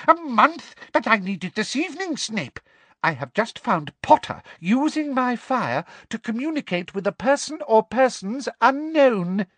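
insistent and outraged; Umbridge's voice. A narrator's reading. Umbridge insists to Snape she needs it tonight.

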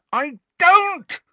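very high, loud, throat-tearing scream; furious boy screaming denial. A narrator's reading. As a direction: scream it with all you have. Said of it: "Harry screamed".

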